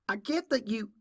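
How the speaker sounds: urgent plea. Acted. Applejack's plea is cut off.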